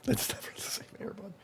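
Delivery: soft, quick whispered repeat; whispered echo. Real, not acted. Echoing his cohost's joke, in a quick whisper.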